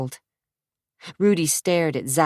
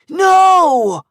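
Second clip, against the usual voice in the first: very high, loud scream of no; desperate no; 14 semitones higher, 10 dB louder than usual. Acted. Zach screams as the knife comes out, panicked scream.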